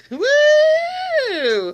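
high whooping cheer; laughing whoop. Real, not acted. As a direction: let out a loud woo. Whoops at the joke.

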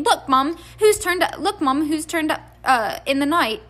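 put-on high, eager kid calling Mum; mock excited announcement. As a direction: act out bounding in with news. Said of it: "you come bounding downstairs saying".

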